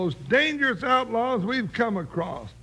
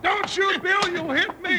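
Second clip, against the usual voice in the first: panicky plea; 7 semitones higher than usual. Acted. Jingles in the line of fire.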